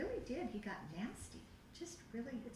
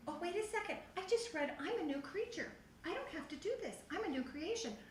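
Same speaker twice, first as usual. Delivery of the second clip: excited realization; 8 semitones higher than usual. Retold or imitated. Retold realization on the phone.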